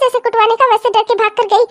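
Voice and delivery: ordinary talk, nothing marked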